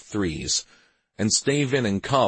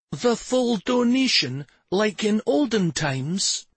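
put-on very high, drawn-out boyish question; excited wonder; 14 semitones higher, slower than usual. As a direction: ask slowly in wide-eyed amazement. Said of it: "He wore an excited expression".

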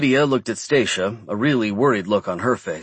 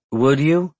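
unconvinced; 4 semitones higher than usual. A narrator's reading.

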